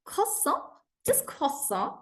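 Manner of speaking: "Croissant" said the French way, silent t, nasal end. Shown as a demonstration. Teacher models croissant in French for the class. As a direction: say "croissant" as kwa-sohn, no final t. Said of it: "because it's French".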